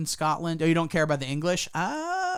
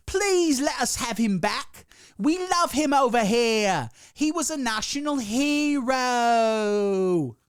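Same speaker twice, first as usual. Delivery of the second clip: higher, slow, whiny begging Brit; mock British pleading; 9 semitones higher, 5 dB louder, much slower than usual. Brits begging to have Piers Morgan back, mock whiny plea. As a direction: beg theatrically, dragging the words out. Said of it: "beg and plead and go".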